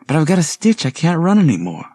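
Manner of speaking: high, loud complaint from the stitch; out of breath. Acted. Suzanne with a stitch pleading to stop, high winded plea. Tired girl complaining while running.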